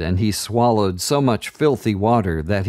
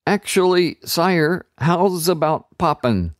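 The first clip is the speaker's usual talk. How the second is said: higher, nervous streetwise offer; street kid voice; 8 semitones higher than usual. A narrator's reading. Sewer rat nervously offering to pop the bubble. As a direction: pitch the idea carefully.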